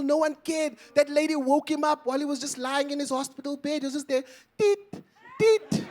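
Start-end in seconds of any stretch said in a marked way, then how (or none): 4.6-5.8 high beeping machine noise; monitor beep imitation. Comic mimicking the hospital monitor, high beeps.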